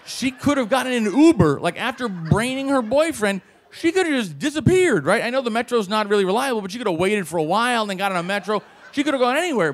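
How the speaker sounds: high-pitched incredulous venting; exasperated rant. Acted. Officer rants to himself about prisoner, exasperated.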